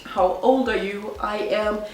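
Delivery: ordinary talk, nothing marked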